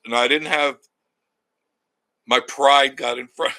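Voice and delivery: ordinary delivery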